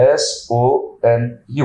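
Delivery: very slow, letter by letter; spelling out. Real, not acted. Marketing head spells his name for viewers, slowly and deliberately. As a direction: spell it with each letter drawn apart.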